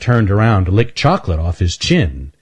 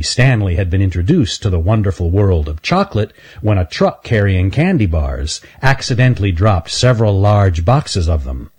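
ordinary delivery

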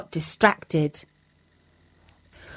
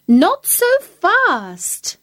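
high, slow cat voice; 10 semitones higher, 3 dB louder, much slower than usual. A narrator's reading. Cat stopping the dog at the river, high and slow.

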